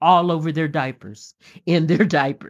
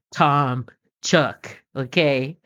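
normal talking voice

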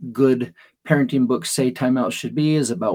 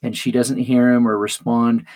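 normal talking voice

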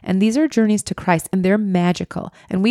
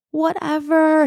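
dismissive whatever; 9 semitones higher, slower than usual. Retold or imitated. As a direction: toss off a bored whatever, high. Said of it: "He's not even listening".

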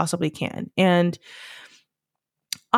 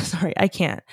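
ordinary talk, nothing marked

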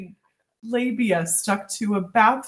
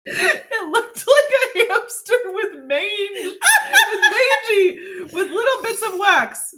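laughing; 12 semitones higher, slower than usual. Real, not acted. Laughing while reading embarrassing story.

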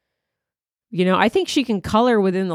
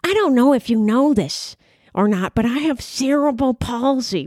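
Miss Neely's voice; 3 semitones higher than usual. Retold or imitated. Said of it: "That's how she sounded".

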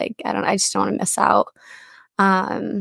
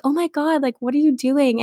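higher-pitched retold outcry; mock alarm; 6 semitones higher than usual. Friend retelling a girl's protest at her shoes being sprayed, mildly alarmed. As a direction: lightly act out an appalled reaction.

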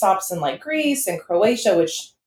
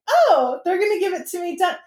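naive expectation; 9 semitones higher than usual. Retold or imitated. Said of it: "And I was like".

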